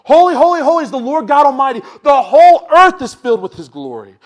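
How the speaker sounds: loud high proclaiming holy; calling out. Acted. Seraphim calling to one another, loud and high. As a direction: cry it out across the temple. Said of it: "kept calling out".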